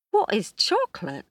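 shocked, rising indignation; gasped. Acted. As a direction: Priya aghast at the question. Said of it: "gasped Priya".

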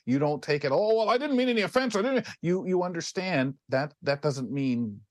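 ordinary talk

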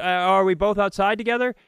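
high, fast, chirpy neighbor voice; a perky neighbour pushing springtime chat; chatty neighbor. Retold or imitated. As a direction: mimic a pushy small-talk neighbor, high and quick. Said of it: "everybody makes talk".

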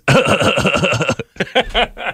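put-on chuckle in a string of pulses; stoner-style mock laugh; imitated West Coast laugh. Shown as a demonstration. Co-host showing how westerners laugh, put-on Seth Rogen-like chuckle. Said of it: "And they laugh like this".

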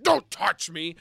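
loud, high yell; imitated outraged drunk; drunken protest. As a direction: shout them off like a belligerent drunk. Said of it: "He's like".

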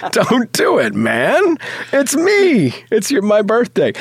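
high-pitched mock desperation, as a kid; desperate pleading. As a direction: plead like a desperate child, hammed up. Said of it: "see the desperation in my eyes".

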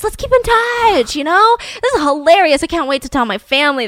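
thrilled passenger planning to stay in touch; giddy passenger. Retold or imitated. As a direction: squeal it like a tipsy new friend. Tipsy passengers celebrating.

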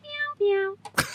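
high, soft cat meows; imitated meowing. Friend teases the storyteller about his noises, mock meowing. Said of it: "you kept going, Meow, meow, meow".

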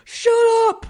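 loud, high mock "shut up"; mock yell. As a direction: bark it at the chatterer, hammed up. Mock shushing a TV talker.

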